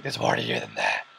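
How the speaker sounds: derin, alçaltılmış Batman taklidi. Retold or imitated. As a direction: sesini aşağı indirip Batman gibi söyle. Batman quote, voiced deep.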